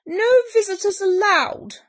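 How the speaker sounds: high, loud, forceful order; stern Bear. A narrator's reading. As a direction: shout the rule at an intruder. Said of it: "Bear said, pointing to the sign".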